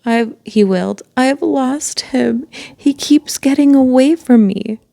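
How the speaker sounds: hammed-up tearful wail. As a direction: wail it like a lost child. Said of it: "and wailed".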